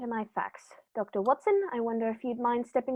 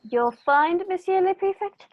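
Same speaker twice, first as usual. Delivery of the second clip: louder and higher, triumphant announcement; dramatic reveal; 8 semitones higher, 7 dB louder, slower than usual. Acted. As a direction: ring it out as the winning card.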